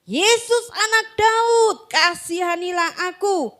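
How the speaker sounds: high, slow plea; crying out. Acted. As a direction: call out to Jesus for mercy. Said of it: "mulailah ia berseru".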